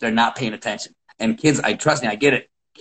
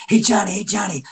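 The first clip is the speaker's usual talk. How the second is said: higher called-out hey, Johnny; calling out; 8 semitones higher than usual. Retold or imitated. Parent calling to son before his match. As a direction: call across the gym to your kid.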